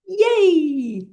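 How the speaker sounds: high, loud cheer of yay; excited cheer. Shown as a demonstration. Teacher shows the class an excited yay, cheering high. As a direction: throw the arms up and cheer. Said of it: "Do that with me".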